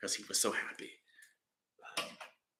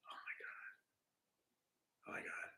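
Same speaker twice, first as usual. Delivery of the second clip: scared whisper; 25 dB quieter, faster than usual. Retold or imitated. Drowsy messenger panics.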